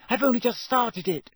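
high-pitched brisk explanation; businesslike, pitched well up. A narrator's reading. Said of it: "said Hermione briskly".

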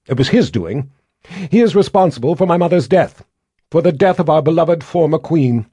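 loud, high, haughty proclamation; haughty queen. A narrator's reading. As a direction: announce it proudly as queen. Violet proclaiming her queenship.

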